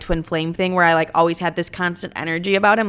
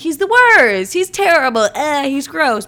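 higher, louder exaggerated loathing; mock disgust; 6 semitones higher, 4 dB louder, slower than usual. Agreeing with her best friend about him, exaggerated disgust. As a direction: trash him in a high, worked-up voice. Acted agreement trashing the guy.

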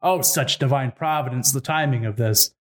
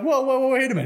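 high-pitched, hurried-sounding plea to stop; mock alarmed protest; 9 semitones higher, much faster than usual. Birds plead as wolf holds their friend, mock alarm. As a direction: play at panicky backing off.

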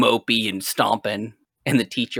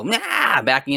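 drawn-out mock meow, pitched way up; yelling cat; 7 semitones higher, slower than usual. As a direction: imitate a cat yelling back. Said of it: "My cat will yell at me".

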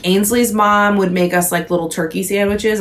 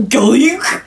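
swooning preteen crush; 7 semitones higher than usual. Retold or imitated. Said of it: "that's why we were like".

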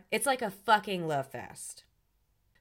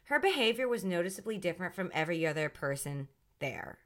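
ordinary delivery

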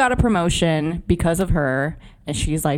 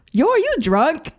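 put-on high, amazed "are you drunk?"; incredulous question; 10 semitones higher than usual. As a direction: ask it in disbelief, pitched way up. Somebody in movie asks drunk cop.

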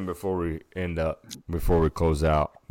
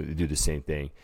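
ordinary delivery

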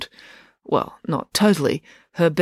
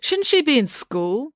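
high, drawn-out challenge; indignant demand; 10 semitones higher, slower than usual. A narrator's reading. April challenging Loretta's presence at home, indignant demand. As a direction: press the question, higher and slower. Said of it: "demanded April".

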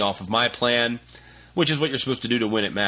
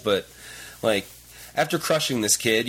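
ordinary talk

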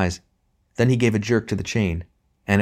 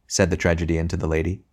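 ordinary delivery, nothing marked

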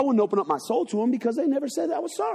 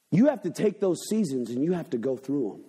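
usual speaking voice

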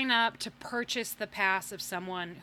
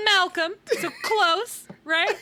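excited; 8 semitones higher, 7 dB louder, slower than usual. Real, not acted. Excited retelling of the game.

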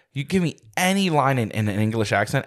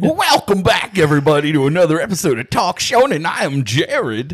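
mock announcer intro; 3 semitones higher, 5 dB louder than usual. Co-host parroting the host's opening to Talk Shonen, loud mimicry. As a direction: belt out the host's welcome, mimicking.

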